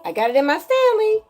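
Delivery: high singsong brag; playful show-off. Real, not acted.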